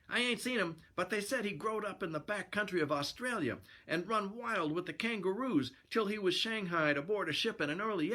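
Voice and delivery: eager high-voiced sidekick; sailor pal. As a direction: spread the rumor in a high character voice.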